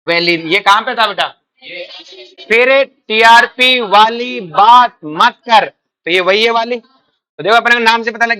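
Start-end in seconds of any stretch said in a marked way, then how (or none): 2.5-5.7 loud and very slow, word by word; emphatic, drawn-out memory line; slow mnemonic recitation. Shown as a demonstration. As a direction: stretch out each word for the class to memorise. Recites next mnemonic line slowly.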